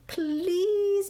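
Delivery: high, drawn-out pleading; imploring storybook bird; begging. A narrator's reading. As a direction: beg with a long, wheedling please. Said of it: "begged the bird".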